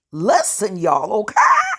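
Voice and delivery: loud, excited call to the audience; triumphant exclamation. Real, not acted. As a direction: shout it with glee, ending in a laugh.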